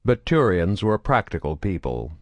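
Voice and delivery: plain reading voice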